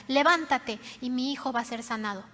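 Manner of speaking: ordinary delivery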